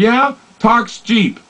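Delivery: mock demanding lover; scoffing partner. Acted demanding visual partner.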